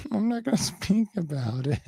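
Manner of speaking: high mimicry between giggles; mock the interviewee in a high, giggly voice; high-pitched teasing parody; giggly mocking imitation.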